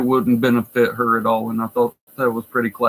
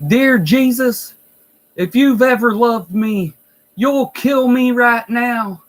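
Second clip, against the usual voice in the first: high, slow mock scream to heaven; drawn-out, high-pitched imitation of an anguished outcry; retold scream; 9 semitones higher, 4 dB louder, slower than usual. Nursing home resident screaming out to Jesus from the hall, mock scream. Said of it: "she screams out".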